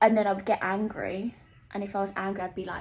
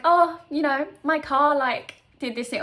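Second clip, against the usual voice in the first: airy, brushing-it-off voice, a little higher and louder; mock breezy deflection; 5 semitones higher, 7 dB louder than usual. To her therapist, dodging the real problem with breezy small talk. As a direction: act like nothing's wrong, lightly. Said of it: "I'm like".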